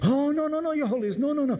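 high, frantic pleading of a nervous Italian driver; upset Italian chauffeur. Acted. Chauffeur begs the Pope to keep the windows up, high and frantic. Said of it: "the Italian chauffeur gets very upset".

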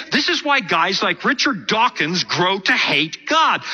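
ordinary delivery

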